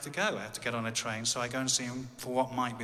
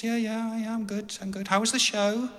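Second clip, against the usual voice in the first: high-pitched phone small talk; voiced wife; 10 semitones higher, 4 dB louder than usual. Wife on phone asking about the show, high voice. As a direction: imitate a wife chatting in a high voice.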